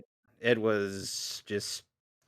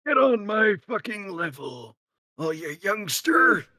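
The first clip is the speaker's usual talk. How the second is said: put-on high, cranky old geezer shouting; old man voice; 9 semitones higher than usual. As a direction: yell at the youngster like a grumpy granddad. Old man ribbing on Windows age.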